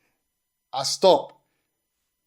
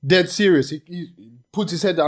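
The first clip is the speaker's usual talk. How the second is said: ordinary delivery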